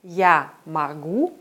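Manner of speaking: slow "я могу" with a long stressed final "гу"; weak first vowel in "могу", hit GU; stress on the ending. Shown as a demonstration. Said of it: "mal auf der Endung".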